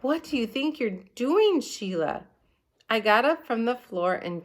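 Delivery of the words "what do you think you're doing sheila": yelled. A narrator's reading. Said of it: "he yelled".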